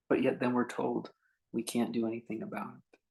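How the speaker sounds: normal talking voice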